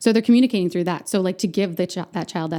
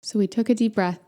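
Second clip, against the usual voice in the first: normal talking voice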